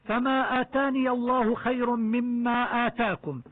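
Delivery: measured chanted recitation of the verse; Quran recitation. Shown as a demonstration. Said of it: "Quran verse in a tajweed lesson". (تلاوة مجودة)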